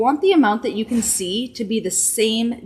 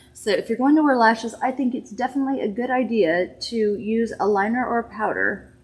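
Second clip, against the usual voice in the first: usual speaking voice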